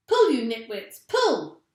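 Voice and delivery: loud bossy shout. A narrator's reading. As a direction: yell the order. Mugglewump ordering everyone.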